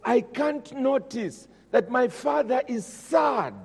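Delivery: preacher pleading at full force; impassioned preaching. Acted. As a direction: drive the confession home to the congregation. Impassioned sermon climb.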